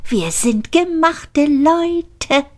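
high, excited cheer; gleeful character. A narrator's reading. Character cheers to Frankie Baby, high and gleeful. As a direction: crow with triumph to Frankie.

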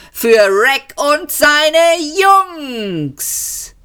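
höher, schwungvoller Conférencier; kündige den Weltuntergang an wie eine Gala; showmaster Max. A narrator's reading. Max kündigt die Band an. (Max begrüßt das Publikum als gut gelaunter Showmaster)

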